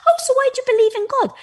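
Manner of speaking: quick, high and loud curious question; husband asking. Retold or imitated. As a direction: fire off the question like a curious date. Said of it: "he turns to me and he's like".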